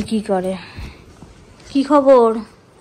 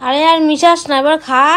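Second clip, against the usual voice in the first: loud impatient push to drink; loud scolding; 6 semitones higher, 9 dB louder than usual. Real, not acted. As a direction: scold her to hurry up and drink it. Hurrying sister to drink it. (জোরে বকুনি দিয়ে তাড়া)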